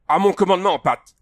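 officer's high shout; crying out. A narrator's reading. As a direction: call the troops to readiness. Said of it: "s'écria Andrew".

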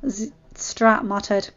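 ordinary delivery, nothing marked

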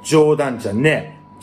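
slow, careful catchphrase; said slowly. Shown as a demonstration. To viewers, slowly saying the catchphrase. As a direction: take it slowly, still getting used to it. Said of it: "ちょっとゆっくり言わないと".

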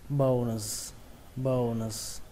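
"bones" as bâu-n plus a drawn-out z; final z added. Shown as a demonstration. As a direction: sound the ending of "bones" after the n. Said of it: "nhớ thêm chữ sờ nữa nhé, chữ giờ". (đọc "bones" có âm giờ cuối)

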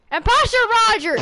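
high, quick mock alarm; urgent accusation. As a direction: call out the impostor in playful alarm. Calling out the imposter in play.

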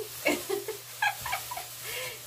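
high-pitched embarrassed giggle. Real, not acted. Laughs at herself singing.

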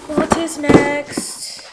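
high, loud and drawn out; big teasing high-pitched question; playful singsong. Real, not acted. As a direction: sing out each word slowly.

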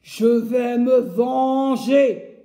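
slow, loud menacing growl; woodcutter growling. Acted. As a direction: snarl the promise of revenge. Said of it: "gronde le bûcheron". (grondement lent et menaçant)

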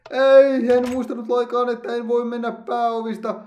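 sudden high shout of dismay, fading; startled. Real, not acted. Gamer suddenly remembering his mistake, a startled high cry. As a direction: blurt it out, suddenly alarmed. Realises he forgot in game.

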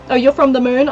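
normal talking voice

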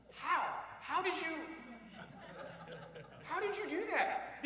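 high-pitched, baffled question through laughter; laughing bewilderment; amused incredulity. Real, not acted. Teasing older listeners, incredulous.

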